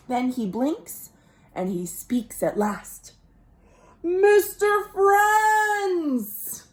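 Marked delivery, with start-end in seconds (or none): 4.0-6.7 slow, drawn-out, higher astonished call; stunned fish. Acted. Fish greets his friends after the kiss, slow amazed cry. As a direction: stretch it out in wonder.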